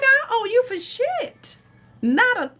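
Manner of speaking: high, tearful, slowed protest; crying yell. Retold or imitated. Refusing to pay the reader on the phone, crying yell. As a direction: yell it through tears. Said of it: "I'm hollering and screaming, crying, snotting".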